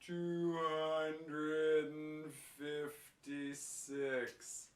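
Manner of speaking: hugely stretched syllables, absurdly slow; extreme slow motion. Shown as a demonstration. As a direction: play it like a slow-motion film, every sound drawn out. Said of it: "I'm in a slow motion movie".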